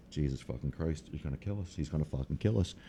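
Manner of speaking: very soft, under-the-breath exclaiming; hushed dismay. Retold or imitated. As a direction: say it low, as a worried mom to herself. Mom's frightened muttering, retold.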